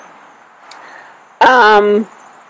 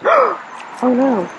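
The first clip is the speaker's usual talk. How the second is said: sharp gasp, then a higher "oh no"; sudden alarm at a broken mic; real dismay; 6 semitones higher, 4 dB quieter than usual. Real, not acted. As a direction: caught off guard as something breaks. Microphone piece falls off.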